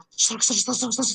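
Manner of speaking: rapid crackly "srak-srak" hiss; imitated static noise. Shown as a demonstration. Trainer imitates noise on the line, crackling sound. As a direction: mimic microphone crackle with the mouth.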